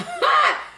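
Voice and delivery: high, loud laugh; burst of laughter. Real, not acted. Streamer reading a viewer's comment, bursting out laughing. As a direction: crack up in one high burst.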